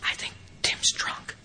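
unvoiced, slow hushed whisper. Members quietly warning about a drunk newcomer, whispering. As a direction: whisper it like a secret in a meeting. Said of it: "whisper and say".

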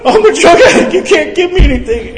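high, loud, laughing through the words; high laughing mock protest; laughing plea. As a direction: crack up while pleading with the doctor. Retelling pleading with ER doctor.

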